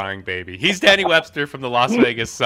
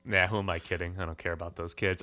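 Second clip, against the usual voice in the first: low, quiet deadpan aside; 5 semitones lower, 8 dB quieter, faster than usual. Real, not acted. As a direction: drop it low and throw it away. Deadpan joke after build-up.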